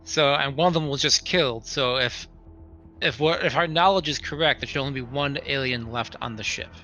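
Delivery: usual speaking voice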